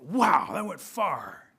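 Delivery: slow, drawn-out wow; amazed. Acted. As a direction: marvel at how far it flew. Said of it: "to Rabbit's surprise".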